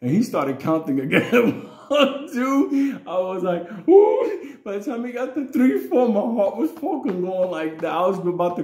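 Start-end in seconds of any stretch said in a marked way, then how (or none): 1.9-3.0 loud, high counting voice; high-pitched mock counting call; imitated counting. Imitates big man counting. 3.9-4.6 high, loud mock-panicked whoop; sudden high yelp of retold dread; alarmed "ooh". Retold panic as counting restarts.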